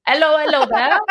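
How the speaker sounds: hammed-up British hello; terrible British accent. Shown as a demonstration. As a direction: put on a bad British accent. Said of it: "I have a terrible British accent".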